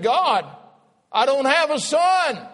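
high, loud, pleading cry; anguished cry to God. Acted. The preacher voices Abraham pleading to God: high anguished cry. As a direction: cry it out like a desperate old man.

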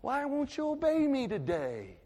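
high, pleading voice of God; high loud divine appeal; God speaking. Acted. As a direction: cry out as God, hurt and urging. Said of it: "and he says".

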